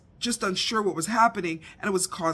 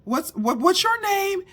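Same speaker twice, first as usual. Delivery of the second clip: confused loud question; 12 semitones higher, 5 dB louder than usual. Retold or imitated. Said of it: "And he's like".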